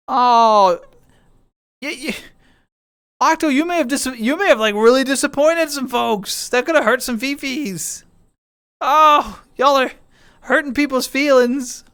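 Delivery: high-pitched teasing sympathy; hammed-up concern, pitched high; mock dismay. Playfully scolds over hurt feelings.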